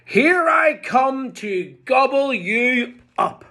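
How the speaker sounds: high shouted threat; bellowing troll. Acted. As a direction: bellow it at the goat.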